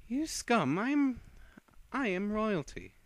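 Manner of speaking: high, loud and imperious, looking down on the enemy; proud vampire princess voice; haughty vampire girl. Acted. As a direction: sneer it out like royalty. Vampire girl's haughty quoted boast.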